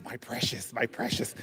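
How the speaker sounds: hushed, whispered creature hoarding treasure; covetous little whisper; Lord of the Rings impression. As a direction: whisper it possessively, very soft and low. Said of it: "that Lord of the Rings".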